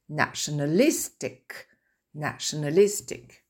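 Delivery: teacher's stress demo; word-stress model. Shown as a demonstration. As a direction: model Nationalistic twice, syllable by syllable. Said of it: "donc je vais le redire".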